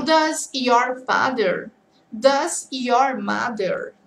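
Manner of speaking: "your father" and "your mother" drawn out slowly; very slow contrasting phrases. Shown as a demonstration. Teacher contrasting "your father" and "your mother" very slowly. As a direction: stretch each phrase so students hear it. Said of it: "Então".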